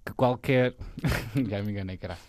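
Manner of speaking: usual speaking voice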